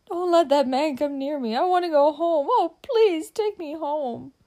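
high, loud, hysterical pleading; frantic tearful girl, drawing out the last plea; sobbing with fear. A narrator's reading. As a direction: wail it in terror, begging. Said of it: "almost beside herself with fear".

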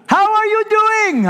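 very high, loud, eager phone greeting; excitable Brother Vic. Retold or imitated. Vic calling the pastor years later, overjoyed. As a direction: greet him like a long-lost friend. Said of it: "excitable kind of guy".

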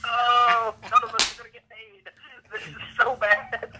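high-pitched, comic lament; mock dismay. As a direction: wail it in mock despair, dragging out the end. Acts out his dismayed reaction, laughing.

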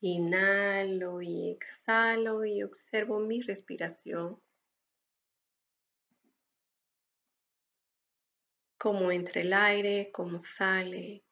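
calm breathing guide, unhurried and gentle; guided meditation. Acted. (voz de meditación guiada)

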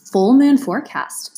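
usual speaking voice

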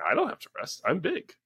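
put-on high-pitched little-kid voice, proud; toddler boast. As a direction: squeak it like a proud small kid insisting he's big. Dad voicing his toddler's boast.

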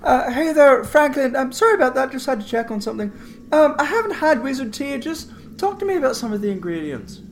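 high, chirpy, apologetic character voice; smooth over an absence brightly in a high voice; Cassidy, breezy apology. Acted. Cassidy back at Franklin's door.

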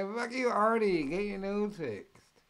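a retold insult thrown hard, then slowing down; mock angry heckle. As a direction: heckle him like a furious comic. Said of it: "'cause they".

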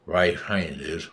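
words slurred together; slurred speech. Retold or imitated. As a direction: slur it like a stroke survivor. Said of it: "in his slurred speech".

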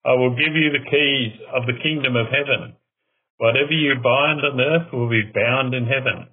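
ordinary delivery

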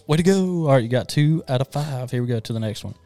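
ordinary delivery

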